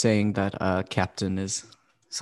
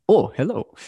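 put-on a light, goofy German accent; 7 semitones higher, 4 dB louder than usual. Student imitating her professor's greeting, goofy German accent. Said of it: "with a goofy German accent".

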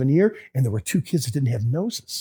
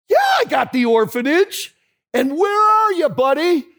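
teasing friend; 11 semitones higher than usual. Retold or imitated. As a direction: needle your pal about where he is. John teasing on phone.

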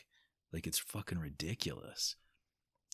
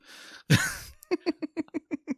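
loud squeal of a laugh; high burst of laughter; 10 dB louder than usual. Real, not acted. Laughing at the angry inner voice, a loud high squeal. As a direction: cracks up at his own outburst.

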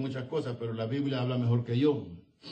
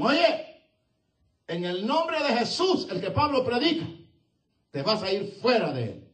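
commanding shout; 9 semitones higher, 6 dB louder than usual. Acted. Disciples commanding the demon.

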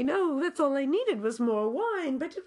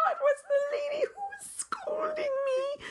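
half-whispered horrified reveal, much higher; mock horrified; 12 semitones higher than usual. Real, not acted. Telling Pippa the scolding lady was the emissary, in a mock-horrified whisper. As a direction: reveal it in a strangled squeal.

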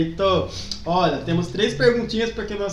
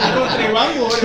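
laughing out loud, words through laughter; 4 semitones higher, 11 dB louder than usual. Real, not acted. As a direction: burst out laughing mid-phrase. Laughing, mocking the announcement.

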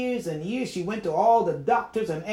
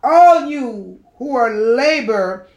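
loud, slow, stretched-out call; preacher's scripture call; 4 semitones higher, 10 dB louder, slower than usual. Real, not acted. Calling the burdened with Jesus's words, slow loud preaching. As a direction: call it out with long pauses between phrases.

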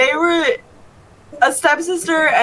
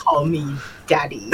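much lower and softer, a laughing fake-deep purr; mock seductive villain; 9 semitones lower, 7 dB quieter than usual. Teasing friends with a deep mock villain line. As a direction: purr it low like a joke villain. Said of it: "mock villain line".

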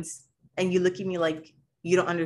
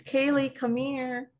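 high, slow sing-song call; a mom calling her daughter, high and drawn out; calling child; 8 semitones higher, slower than usual. Retold or imitated. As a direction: call the kid sweetly.

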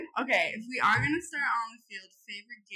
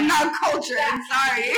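loud laughing apology; laughing shout; 4 semitones higher, 12 dB louder than usual. Real, not acted.

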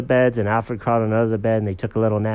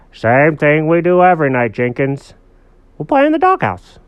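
louder, higher storybook dog; emphatic dog buddy, pitched up; dog character voice; 5 semitones higher, 7 dB louder than usual. Acted. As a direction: declare the plan as a cartoon dog. Dog answers cat.

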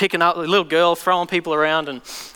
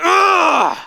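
high, loud demonic shriek; demon's shriek; 9 semitones higher, 5 dB louder than usual. Retold or imitated. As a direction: let out a pained shriek. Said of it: "it would, like".

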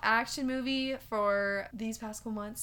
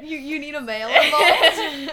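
loud, laughing disbelief; laughing question; 4 semitones higher, 13 dB louder than usual. Real, not acted. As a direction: ask it loudly through laughter. Incredulous question during shared laughter.

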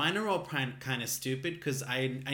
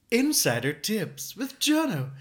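higher, louder and slow, like a show title; segment title announcement; 9 semitones higher, 8 dB louder, slower than usual. Acted. Naming his new segment, higher slow announcer voice. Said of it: "we'll call this".

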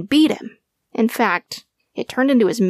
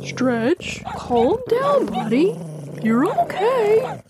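gentle, soothing owner calming his dog; an owner soothing his frightened dog; 12 semitones higher, much slower than usual. A narrator's reading. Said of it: "in his calm voice".

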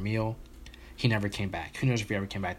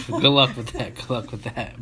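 loud, slowed reply through a laugh; 2 semitones higher, 5 dB louder than usual. Real, not acted. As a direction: say it while cracking up at a friend. Amused reply to friend's plan.

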